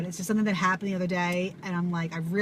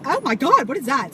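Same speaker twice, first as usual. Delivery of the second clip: high, loud alarmed gasp of words; freaked-out cry; startled; 5 semitones higher, 6 dB louder than usual. Retold or imitated. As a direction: recoil at the bug. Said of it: "And I'm like".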